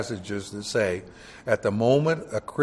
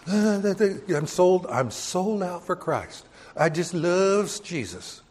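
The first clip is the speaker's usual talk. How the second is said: mock pious churchgoer; 5 semitones higher, 3 dB quieter than usual. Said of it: "You ever heard Christians".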